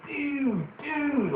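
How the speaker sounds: high drawn-out surfer "dude"; imitating a California surfer dude. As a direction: call out dude like a stoner surfer. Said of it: "'cause he's always saying".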